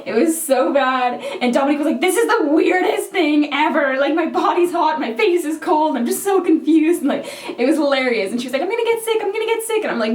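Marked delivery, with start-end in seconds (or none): 2.0-7.0 a hint of a higher, bewildered Dominique; lightly voiced friend, puzzled in the snow; imitating Dominique. Friend describes hot tub, lightly voiced bewildered imitation. 8.6-9.8 much higher, mock-whiny worry; whiny worried friend. Friend frets about sickness, high mock-whiny imitation.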